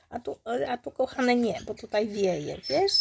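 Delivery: ordinary delivery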